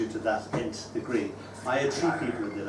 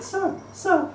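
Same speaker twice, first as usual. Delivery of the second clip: much higher, eager schoolkid voice; child pupil. Retold or imitated. Pupil answering the teacher, high and eager. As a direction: pipe up like a keen year six pupil. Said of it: "And they said".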